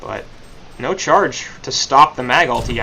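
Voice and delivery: loud, rising fight call, fast; excited play-by-play. Acted. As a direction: shout the big teamfight as it happens. Live esports play-by-play.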